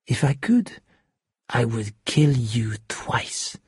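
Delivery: soft death threat, slowing at the end; quiet menace. A narrator's reading. Shark threatening to kill Hero, quietly menacing. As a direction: let the threat sink down slow and hushed.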